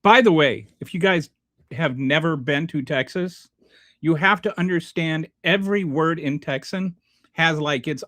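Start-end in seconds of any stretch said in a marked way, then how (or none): none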